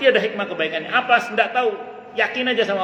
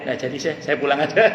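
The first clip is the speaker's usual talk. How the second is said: student backing off. Retold or imitated. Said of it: "Kata muridnya".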